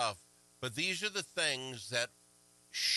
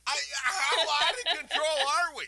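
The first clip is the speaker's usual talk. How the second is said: much higher and louder, slow, disbelieving; exasperated outburst; 13 semitones higher, 8 dB louder than usual. Real, not acted. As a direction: throw up your hands in disbelief. Outburst about double monitors.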